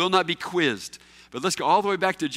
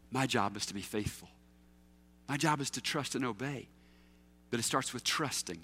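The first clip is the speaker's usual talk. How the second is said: softer, lower, sincere; quiet sincere; 7 semitones lower, 9 dB quieter than usual. Real, not acted. Preacher telling the congregation their job, softer and sincere. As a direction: lean in and say it gently.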